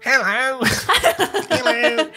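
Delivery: high squeaky little rat. Acted. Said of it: "It's the same voice".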